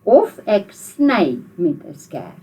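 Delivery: slow model sentence. Shown as a demonstration. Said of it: "Sê dit".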